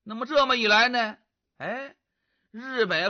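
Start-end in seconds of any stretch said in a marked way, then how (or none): none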